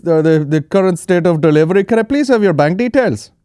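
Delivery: high, loud put-on scam caller voice; hammed-up phone scammer, high and loud; mock call-centre caller. Scam caller on the phone, a high loud mocking voice. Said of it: "we're just checking up".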